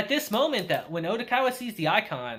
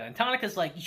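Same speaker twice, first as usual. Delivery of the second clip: ordinary talk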